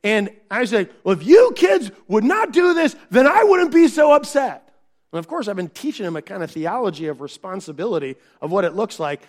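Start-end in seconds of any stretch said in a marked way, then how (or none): 1.0-4.7 raised, high-pitched exasperated scolding; loud, worked-up dad blaming the kids; frustrated parent. Retold or imitated. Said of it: "They can see that I'm frustrated".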